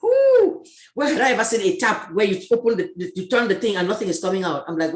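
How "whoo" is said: high, loud whoo of shock; startled whoo. Real, not acted. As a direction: let out a sharp high whoo. Recalling the shock at school.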